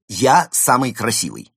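loud, assured boast; self-admiring demonstration. Shown as a demonstration. Panda showing the penguin how to praise himself, assured. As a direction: declare it proudly, with full conviction. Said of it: "Скажите себе".

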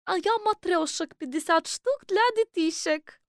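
high put-on character voice; high-voiced traveller answering customs.

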